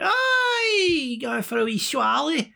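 very high, drawn-out Scottish holler; hammed-up Scottish shout, slow and stretched; broad Scottish accent. [name] announcing a drink, exaggerated Scottish accent. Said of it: "Kevin Bridges type Scottish".

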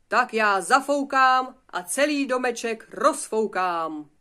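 loud, slow, drawn-out threat; shouted wolf threat. Acted. Wolf threatening to blow the straw house down, shouting. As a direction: shout it slowly, stretching each word. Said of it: "vykřikl vlk".

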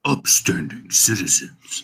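slow, lightly voiced Mando; a hint of Mando's slow low delivery; Mando impression. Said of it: "Did I do it like Mando would?".

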